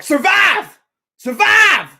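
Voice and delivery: high, loud shouted survive; imitating a frantic movie shout; Robert Downey Jr. yelling. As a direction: bellow it like a desperate soldier under fire. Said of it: "Robert Downey Junior is like".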